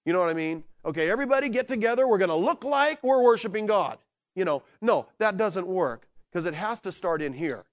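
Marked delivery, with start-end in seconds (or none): 0.8-4.0 fast, pushy hammed-up cheerleader; mock worship leader. Leader telling the crowd to look like they worship, hammed-up and fast. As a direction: rush them into fake worship. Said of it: "places where they try to tell you to look like you're rejoicing".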